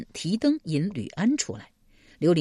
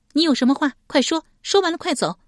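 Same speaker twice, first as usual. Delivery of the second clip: high, sharp sulky woman; 10 semitones higher, 4 dB louder, faster than usual. A narrator's reading. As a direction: rush them along in a high huffy voice. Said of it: "史佩赌气往堂中坐下道". (赌气地高声催促)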